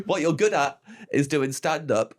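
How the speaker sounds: ordinary talk, nothing marked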